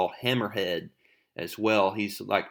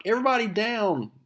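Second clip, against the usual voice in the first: much higher warning call; warning shout; 11 semitones higher, faster than usual. Retold or imitated. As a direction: yell it to get everyone down.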